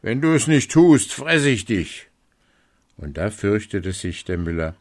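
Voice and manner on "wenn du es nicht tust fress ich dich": louder, menacing wolf threat; threatening wolf. A narrator's reading. Wolf threatening the miller, louder and menacing. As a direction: threaten him to his face. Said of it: "aber der Wolf drohte".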